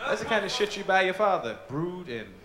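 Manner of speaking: ordinary talk, nothing marked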